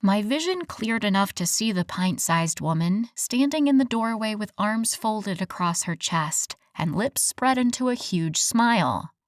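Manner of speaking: plain reading voice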